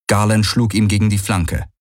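ordinary delivery

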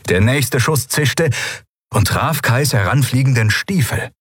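ordinary delivery, nothing marked

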